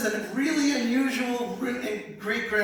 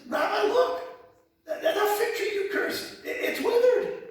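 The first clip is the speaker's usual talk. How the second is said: excited Peter; 12 semitones higher than usual. Acted. Peter excitedly points at withered tree.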